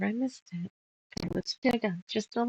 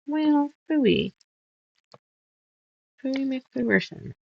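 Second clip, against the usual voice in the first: playful mock annoyance; 7 semitones higher, 6 dB louder, slower than usual. Real, not acted.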